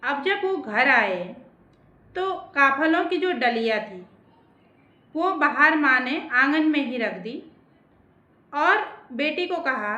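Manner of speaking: ordinary delivery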